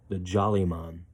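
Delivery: ordinary delivery, nothing marked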